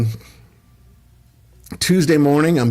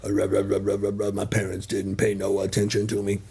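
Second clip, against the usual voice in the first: slow, lower growly monster rumble; Cookie Monster voice; 4 semitones lower, 6 dB quieter than usual. Pretend. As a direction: croak it out like a muppet singing metal. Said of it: "With the Cookie Monster voice".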